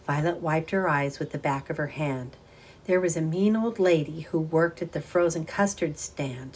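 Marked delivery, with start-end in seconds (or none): none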